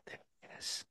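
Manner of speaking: hushed excited whisper to himself. Retold or imitated. Fan spotting Ryan Whitney outside, whispered under his breath. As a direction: breathe it out like you just spotted your hero.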